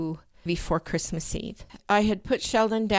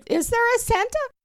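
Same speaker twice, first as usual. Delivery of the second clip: stunned disbelief; 3 dB louder than usual. Retold or imitated. Stunned by box from Santa.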